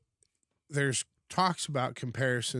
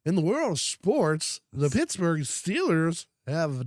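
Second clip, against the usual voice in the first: high, loud, slow announcer; sports broadcaster voice; 6 semitones higher, 6 dB louder, slower than usual. Pretend. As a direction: boom it like a sportscaster. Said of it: "talk like a newscaster".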